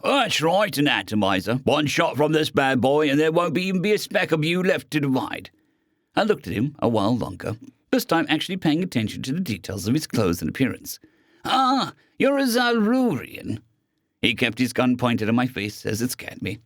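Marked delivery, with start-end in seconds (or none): 0.0-5.5 menacing robot gunman. Acted. 11.4-13.6 amused recognition. Acted. Recognizing the alien.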